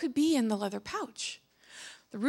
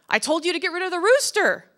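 raised, higher voice; exasperated scolding; 6 semitones higher, 5 dB louder, faster than usual. Acted. Sultan to his failed guards, exasperated scolding. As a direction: scold them loudly.

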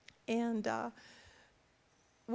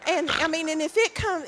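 suddenly much louder, laughing through it; excited loud insistence; loud emphatic; 3 semitones higher, 10 dB louder than usual. Real, not acted. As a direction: push it out loud with a grin. Laughing emphatic aside about Kent.

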